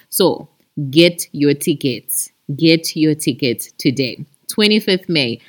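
normal talking voice

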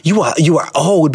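ordinary talk, nothing marked

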